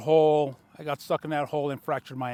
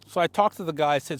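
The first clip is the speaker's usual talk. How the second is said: ordinary talk, nothing marked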